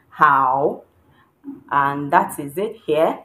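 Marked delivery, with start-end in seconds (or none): 0.1-0.8 "Hao" sinking low, then swinging up; falling-rising tone. Shown as a demonstration. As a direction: dip and lift within "Hao". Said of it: "Falling, rising".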